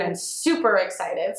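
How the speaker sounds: normal talking voice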